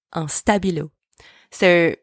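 normal talking voice

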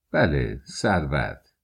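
understated curt answer, pitched low; curt reply. A narrator's reading. Lodger answering the maid about riches, curtly. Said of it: "سپس به تندی گفت".

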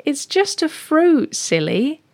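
high, slow, indulgent lady cat; amused neighbour cat. Acted. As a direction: gently explain to a silly kitten, drawn out and high. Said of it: "starts laughing ... And she goes".

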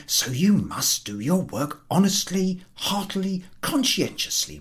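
lightly voiced, resolved and self-scolding; self-lecturing ticket collector. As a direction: give himself a stern pep talk. Potyarkin's drunken self-lecture.

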